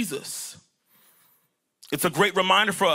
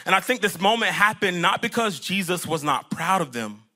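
usual speaking voice

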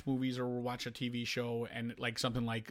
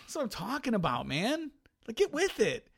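exasperated retort; 9 semitones higher than usual. Retold or imitated. Husband's own exasperated retort.

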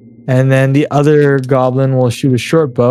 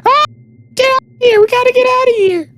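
very high, panicked goblin screech; high squealing creature panic; screaming goblins; faster than usual. Acted. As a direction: scream it out like a frightened little goblin. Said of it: "you can hear the goblins scream, like".

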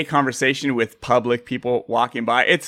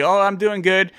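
higher, put-on cheery reply; fake cheerfulness; 6 semitones higher than usual. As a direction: pretend everything is fine. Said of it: "it's all fake".

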